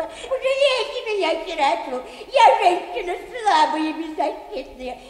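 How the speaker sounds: put-on a touch of a pleading old woman; lightly voiced wheedling widow; whining petitioner. Old woman begging the bank director, lightly whining.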